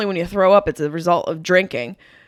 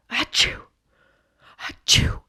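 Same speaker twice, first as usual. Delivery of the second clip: fake sneeze; 3 semitones higher, slower than usual. Shown as a demonstration. Demo of elbow sneeze.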